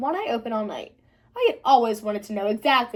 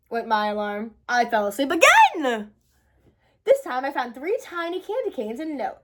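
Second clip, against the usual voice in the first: ordinary delivery, nothing marked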